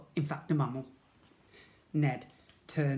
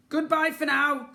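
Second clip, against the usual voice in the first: high, loud teasing farewell; cheeky goodbye; 12 semitones higher, 8 dB louder than usual. A narrator's reading. The sloot teasing Jemima goodbye, high and loud. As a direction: wave her off playfully. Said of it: "said the sloot".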